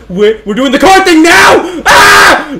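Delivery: high, loud panicked yell; terrified shout. Real, not acted. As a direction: scream as the car comes. Car chase starts suddenly.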